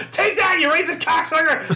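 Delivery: high shrieking cackle and laughing shout; old lady cackling at the cashier. Retold or imitated. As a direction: cackle and yell it in triumph. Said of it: "She's like".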